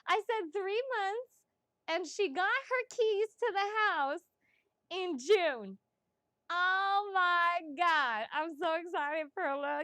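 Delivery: proud, excited reading reaction; excited gushing. Real, not acted. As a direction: savour it, thrilled.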